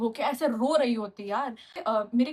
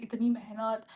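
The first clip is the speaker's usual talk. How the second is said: usual speaking voice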